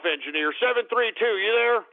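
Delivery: ordinary talk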